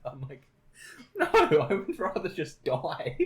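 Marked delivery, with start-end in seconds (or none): 1.2-3.2 slow, high-pitched comic protest; hammed-up refusal with a laugh; exasperated refusal. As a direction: draw it out in mock despair. Acted quarrel, refusing friend's hobby.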